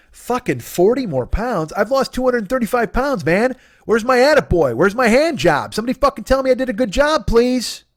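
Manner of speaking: hammed-up self-pitying complaint; indignant whine. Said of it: "I went".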